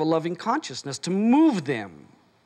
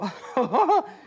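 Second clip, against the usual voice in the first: imitated laugh; 7 semitones higher than usual. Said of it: "they would just start go".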